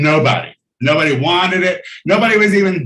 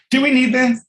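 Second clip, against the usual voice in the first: exasperated; 10 semitones higher than usual. Real, not acted. Exasperated aside about the doll.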